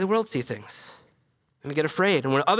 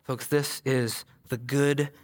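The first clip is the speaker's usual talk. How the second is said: hushed reverent; 5 semitones lower, 5 dB quieter, slower than usual. Real, not acted. Quiet reverent climax.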